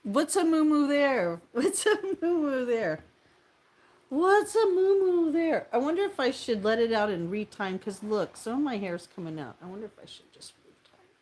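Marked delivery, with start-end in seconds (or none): 0.0-5.7 higher, slow, louder comic read; mock reading. Reading a chat question aloud, hammed-up higher voice. 9.6-11.1 whisper. Real, not acted. Whispered aside.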